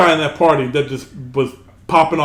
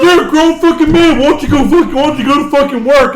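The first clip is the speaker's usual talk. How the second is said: mock taunt; 8 semitones higher, 4 dB louder than usual. Teens taunting him.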